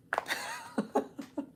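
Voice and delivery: real high-pitched giggle; laughing. Real, not acted. Reader laughing in sympathy with a client, high soft giggling. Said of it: "I'm just laughing".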